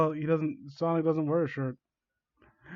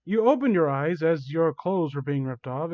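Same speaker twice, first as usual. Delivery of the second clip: usual speaking voice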